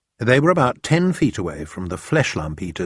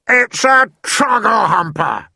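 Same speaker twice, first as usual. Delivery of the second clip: higher, louder, slow giant shout; giant's shout; 13 semitones higher, 6 dB louder, slower than usual. A narrator's reading. BFG to Sophie, naming the nightmare, shouting. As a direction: bellow out the monster's name in alarm. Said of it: "he shouted".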